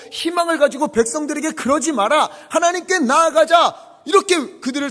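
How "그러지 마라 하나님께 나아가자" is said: rallying call. Retold or imitated. Leader rallying people.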